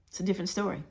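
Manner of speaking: ordinary delivery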